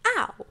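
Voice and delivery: very high loud ow; high loud yelp; sharp yelp. Real, not acted. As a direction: squeal it in sympathy. Reader yelps mid-rant.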